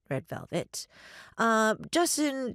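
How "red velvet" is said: low, soft aside; under the breath. Real, not acted. Reader marking a song title among the desserts, under her breath. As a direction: drop to a quiet mutter. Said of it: "I read them under my breath".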